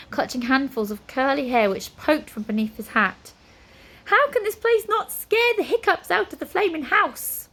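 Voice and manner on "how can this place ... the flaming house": high, despairing wizard; high exasperated wizard. A narrator's reading. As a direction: throw up your hands, voice high. Flabbergast despairing.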